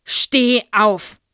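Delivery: furious, very loud bark; raging headmistress orders pupil up. A narrator's reading. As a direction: snap the command at the child. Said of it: "bellte sie".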